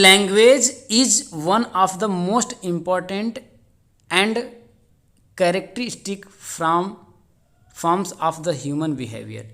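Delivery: very slow, word by word; slow dictation. Shown as a demonstration. Teacher reading the exam statement to students, very slow dictation. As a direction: read it like dictating to a class. Reads exam question aloud.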